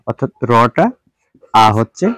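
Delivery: ordinary talk, nothing marked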